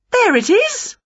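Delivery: high, delighted exclamation; excited discovery. Acted. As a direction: cry out high as you spot it. Winnie spots what she wants.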